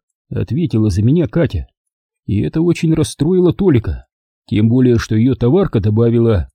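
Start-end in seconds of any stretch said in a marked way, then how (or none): none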